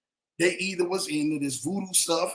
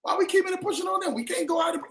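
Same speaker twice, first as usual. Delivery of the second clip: put-on high, fast whining child, pitched way up; high whiny kid; 9 semitones higher, 4 dB quieter than usual. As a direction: play the whining kid begging mama, voice up high.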